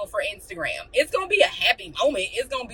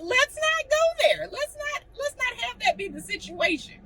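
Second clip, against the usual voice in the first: high mock warning; 14 semitones higher than usual. Real, not acted. Warning about her anger.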